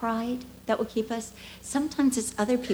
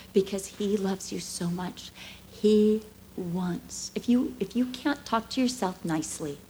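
usual speaking voice

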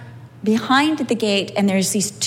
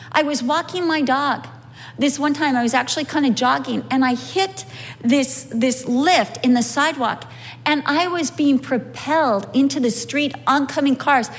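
ordinary delivery

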